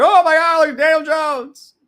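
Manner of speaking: high, loud mock fan shout; hammed-up excited crowd yell; imitating excited fans. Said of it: "ten people said that I look like Daniel Jones".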